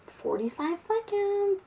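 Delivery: very high, drawn-out voice; silly high-pitched time announcement; playful countdown call. Pretend. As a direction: stretch it out slowly way up high.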